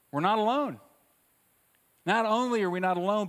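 impassioned proclamation to the congregation; rising preacher's call. Real, not acted. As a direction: lift it up and proclaim it. Preacher builds intensity.

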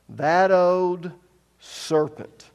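emphatic repeat. Shown as a demonstration.

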